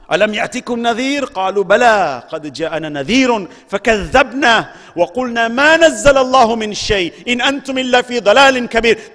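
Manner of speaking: quick, fast chanted verse; rapid Quran recitation. Acted. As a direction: rattle off the verse in one driving breath.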